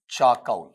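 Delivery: चाकौल as "chaa-kaul", no "r"; correct pronunciation. Shown as a demonstration. Teacher showing how it is said, चाकौल without "r". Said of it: "उच्चारण इस तरीके से आएगा".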